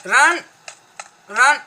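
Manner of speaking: loud panicked shout; shouting run. Real, not acted. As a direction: yell at the partner to run. Panicked calling out.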